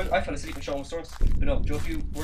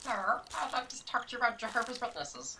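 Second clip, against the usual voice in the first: door-to-door preacher; 9 semitones higher, 10 dB quieter than usual. Pretend. Door-knocker bit, high.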